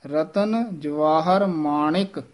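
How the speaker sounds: ਮਾਣਿਕ with a clear short i; each word separate, ਮਾਣਿਕ not ਮਾਣਕ; ਰਤਨ, ਜਵਾਹਰ, ਮਾਣਿਕ. Shown as a demonstration. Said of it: "ਨਾਣੇ ਨੂੰ ਸਿਹਾਰੀ ਲੱਗੀ ਹੋਈ ਹੈ".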